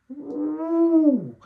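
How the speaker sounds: long high ooh of dread. Real, not acted. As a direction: draw out a high wincing ooh. Dreading calling dad.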